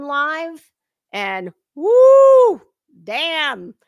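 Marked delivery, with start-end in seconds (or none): 1.7-2.6 high loud woo of disbelief; excited whoop. Real, not acted. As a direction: let out a shocked whoop. Reacting to shocking live.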